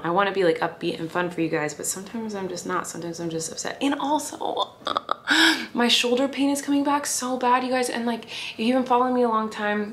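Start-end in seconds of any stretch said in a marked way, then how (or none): 4.7-5.7 sharp gasp. Real, not acted. Sudden shoulder pain interrupts.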